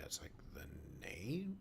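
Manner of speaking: ordinary talk, nothing marked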